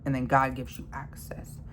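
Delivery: hushed unvoiced whisper about God. Real, not acted. Ranting vlogger drops to a whisper about God. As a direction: drop to a secret whisper.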